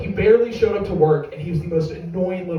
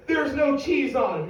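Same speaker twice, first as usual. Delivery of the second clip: high pitch, no longer shouted; incredulous question; 8 semitones higher than usual. Acted. Argument over remade burgers, high incredulous complaint. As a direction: let the outrage drop into high disbelief. Acted outraged question, easing off.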